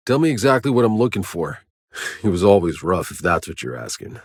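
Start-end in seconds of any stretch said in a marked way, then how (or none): none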